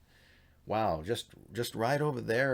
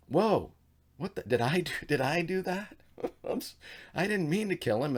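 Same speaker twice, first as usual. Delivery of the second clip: shocked whoa; 8 semitones higher than usual. Retold or imitated. Sethite after killing the Canaanite, high startled dismay. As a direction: gasp out a guilty oops.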